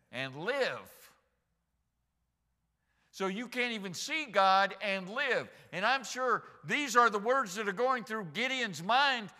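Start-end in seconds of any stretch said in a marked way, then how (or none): 3.1-9.3 high, loud, driving sermon delivery; full-force preaching. Acted. Preacher driving home Gideon's realization that he has seen God, full-force preaching.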